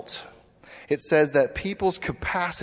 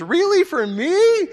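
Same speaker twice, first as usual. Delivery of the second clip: high squeal of delight, much higher and louder; mock gushing; 6 dB louder, slower than usual. Woman squeals to the man about a surprise. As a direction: gush like she can't believe her luck. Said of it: "And she goes".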